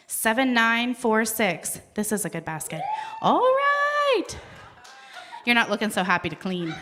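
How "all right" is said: high, drawn-out celebratory shout; excited cheer. Real, not acted. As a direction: whoop it high for the winner. Cheering a raffle winner.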